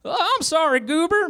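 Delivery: very high, squeaky apology; Barney stammering. A narrator's reading. Barney caught out by Goober, high stammering apology. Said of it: "Barney stammered".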